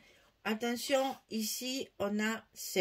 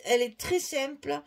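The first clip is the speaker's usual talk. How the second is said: ordinary talk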